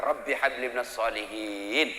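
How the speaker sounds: slow, low, soft prayer; gentle prayer. Pretend. Contrast to the harsh parent, gentle slow blessing. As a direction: bless the child softly and slowly.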